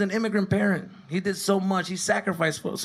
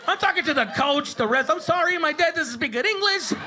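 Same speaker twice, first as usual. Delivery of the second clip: panicked son; 10 semitones higher than usual. Retold or imitated. Son apologizing to refs.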